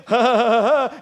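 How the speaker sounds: loud, high mock laugh; imitated laughter. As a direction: force out a loud ha-ha-ha. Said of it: "she would sit there and say".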